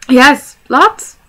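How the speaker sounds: high, loud, blurted answer; hobbit blurting. Acted. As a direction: let it slip out too eagerly.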